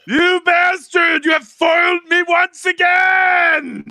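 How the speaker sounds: high, loud, drawn-out theatrical bellow; mock villain-cursing shout. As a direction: curse your nemesis to the heavens. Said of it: "it is actually customary to say that".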